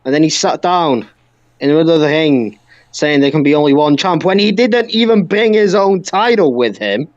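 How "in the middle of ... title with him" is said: ordinary talk, nothing marked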